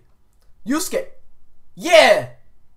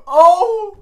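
shocked gasp; 5 semitones higher, 4 dB louder than usual. Real, not acted. Shocked at the middle finger.

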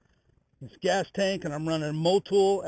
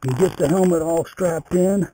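muffled Bluetooth helmet mic; talking from inside a closed helmet; 7 dB louder than usual. Real, not acted. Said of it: "sound is probably gonna get a lot worse because I'm using a Bluetooth".

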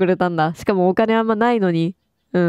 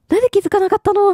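high-pitched disbelief; 11 semitones higher, much faster than usual. Real, not acted. Gamer kicking themself for missing a clue, high-pitched disbelief. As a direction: voice shoots way up. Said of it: "もう高音になるわ".

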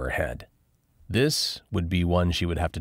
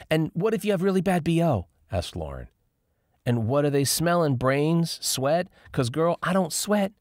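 Lauren's voice; 10 semitones higher than usual. A narrator's reading. Lauren asks, high voice.

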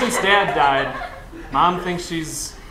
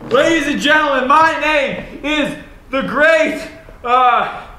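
announcer voice; 9 semitones higher than usual. Acted. Showman announcing himself.

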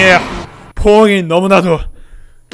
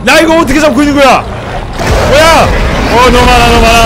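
higher, louder panicked yelling; 5 semitones higher, 7 dB louder than usual. Real, not acted. Gamer swarmed by too many enemies yells in loud panic. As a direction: yell in real alarm at the swarm.

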